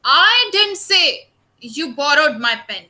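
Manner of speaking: "I" punched, rest falls away; teacher drilling stress on "I". Shown as a demonstration. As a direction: hit the word "I" hard, then glide down. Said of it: "I is bold".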